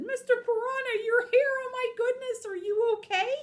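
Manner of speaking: very high, loud cartoon exclamation; high-pitched gushing concern; alarmed relief. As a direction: cry out in hammed-up shock at finding a friend. Wolf alarmed, finding his friend.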